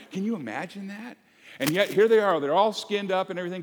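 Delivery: loud, high, driving sermon delivery; full-force preaching. Acted. Pastor rousing the congregation, preaching hard and loud. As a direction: preach it out to the whole room.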